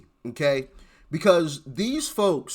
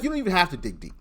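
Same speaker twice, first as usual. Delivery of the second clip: ordinary delivery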